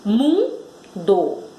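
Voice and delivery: "Mundo" broken in two with a pause; syllable by syllable. Shown as a demonstration. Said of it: "Quantas sílabas? Duas".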